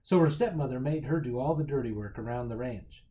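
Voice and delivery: ordinary delivery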